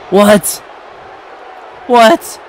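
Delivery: high, incredulous what, twice; you can't believe what you just saw; stunned disbelief. Real, not acted. Stunned by the kick-out.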